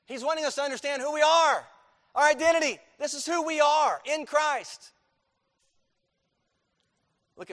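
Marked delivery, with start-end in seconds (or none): 0.1-4.9 loud, high preaching, hammering each phrase; full-force preaching. Acted. Pastor drives the point home to his church, loud full-force preaching. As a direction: preach it hard to the congregation. Stressing identity in Christ.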